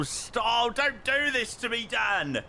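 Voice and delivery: high-pitched exasperated plea; dismayed pleading. Real, not acted. Streamer protesting to Dan in chat, dismayed pleading.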